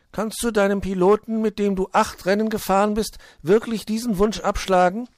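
hohe, laute, flehende Vogelstimme; bettelnder kleiner Vogel. Acted. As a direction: bettle eindringlich als kleiner Vogel. Zwitschi pleads with Borstel. (eindringlich bittender kleiner Vogel)

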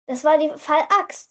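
ordinary delivery

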